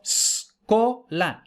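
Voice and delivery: scola with a long separate hissed S; S as syllable. Shown as a demonstration. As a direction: hold the S, then co, la in scola. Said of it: "la S es una sílaba".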